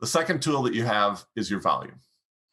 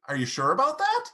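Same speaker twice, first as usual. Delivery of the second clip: doubtful question rise. Shown as a demonstration. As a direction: sound unsure, like questioning it. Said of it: "Not going up like".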